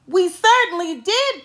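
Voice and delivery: put-on high, lively kids' cry; eager cry. A narrator's reading. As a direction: call out gladly together. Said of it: "cried all the kids".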